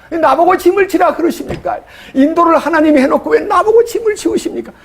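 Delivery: high, exasperated pleading; indignant protest. Retold or imitated. Moses protesting the burden to God, high exasperated plea. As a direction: throw the question up at God, pitched high.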